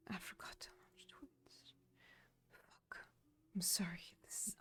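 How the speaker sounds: hushed unvoiced self-muttering; whispering. Real, not acted. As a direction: whisper it to yourself.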